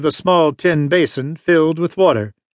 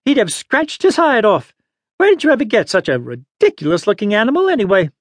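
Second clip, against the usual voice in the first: put-on high-pitched huffy old woman; indignant scolding in an old lady's voice; 6 semitones higher than usual. A narrator's reading. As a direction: huff it high, full of indignation. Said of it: "the old lady said indignantly".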